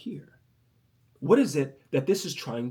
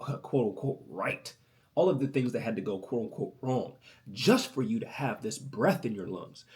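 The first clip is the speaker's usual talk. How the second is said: normal talking voice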